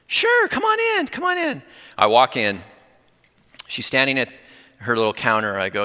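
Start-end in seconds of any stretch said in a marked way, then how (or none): 0.1-1.6 very high sing-out invitation; calling out welcome. Retold or imitated. Woman inviting the pastor in through the garage, calling out. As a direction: imitate a woman hollering "come in" from indoors.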